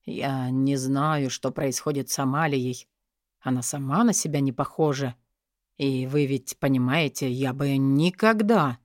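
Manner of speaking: low-voiced man, halting and apologetic; troubled lord. A narrator's reading. Brother excusing his sister's behaviour, troubled.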